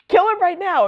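high, pushy mock Jason demand. Jason urging Batman to kill the Joker, high mock demand. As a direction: demand it in a squeaky voice. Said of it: "And Jason's like".

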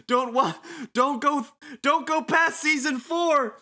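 high, drawn-out melodramatic warning; mock desperate plea. Mock plea parodying Lost.

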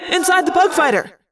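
high-pitched shouted direction; urgent yell. A narrator's reading. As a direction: yell where to take cover. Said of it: "I yelled".

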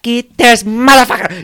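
high, loud, drawn-out vendor's yell; furious yell. Retold or imitated. Vendor catching a hat thief, furious yell. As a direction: imitate an outraged vendor yelling. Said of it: "He was yelling so loud".

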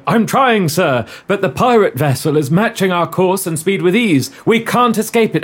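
ship officer voice. Acted. Actor reading radio play line.

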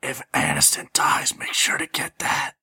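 slow, voiceless whisper aside; conspiratorial whisper. Acted. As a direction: lean in and whisper it secretly. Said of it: "lean over and whisper to Laren".

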